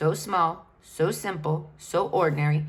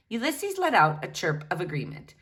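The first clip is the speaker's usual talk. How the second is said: plain reading voice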